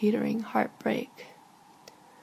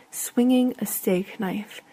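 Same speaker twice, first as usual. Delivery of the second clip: usual speaking voice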